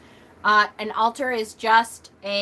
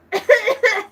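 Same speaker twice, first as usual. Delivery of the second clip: laughing cough; 12 semitones higher, 7 dB louder than usual. Retold or imitated. Choking on spit at office.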